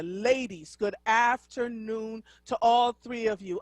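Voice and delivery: normal talking voice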